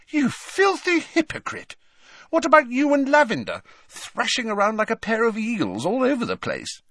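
high-pitched outraged accusation; outraged, very high-pitched challenge; indignant demand. A narrator's reading. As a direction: fire back in shrieky indignation. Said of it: "demanded Ginny".

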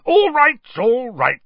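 loud, high, flustered outburst; loudly. Acted. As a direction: cut him off, loud and panicky. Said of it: "said Uncle Vernon loudly".

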